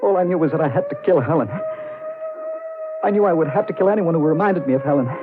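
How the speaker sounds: building from tense to frantic shriek; rising frenzy. Acted. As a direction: let the madness climb to a scream. Strangling, narrator's frenzy builds.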